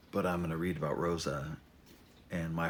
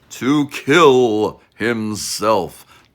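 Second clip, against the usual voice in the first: very loud, slow, hammed-up ominous boom; over-the-top doom voice, dragged out slowly; mock dramatic; 16 dB louder, much slower than usual. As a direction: boom it out like a cheesy movie trailer. Mock dramatic repeat of the phrase.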